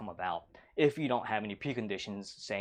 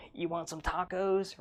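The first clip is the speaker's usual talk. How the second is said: slow, higher, lightly creepy offer; creepy guy; 6 semitones higher, slower than usual. Retold or imitated. Troll tempts Molly with tacos, slow mock creepy. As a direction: dangle the tacos like a mild creep. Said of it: "He's all creepy".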